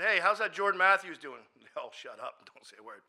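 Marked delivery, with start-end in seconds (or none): 0.0-1.4 loud, raised-pitch question thrown to a room; loud breezy inquiry. Retold or imitated. Asking probation officers about a friend, loud inquiry. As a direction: call it out high and loud.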